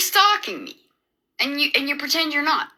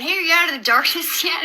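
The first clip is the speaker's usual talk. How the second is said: mocking taunt; 7 semitones higher, faster than usual. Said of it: "mocking me".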